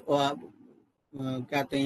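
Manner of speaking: usual speaking voice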